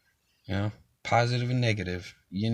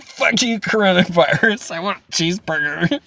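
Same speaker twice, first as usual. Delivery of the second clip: mock angry outburst; 7 semitones higher, 7 dB louder than usual.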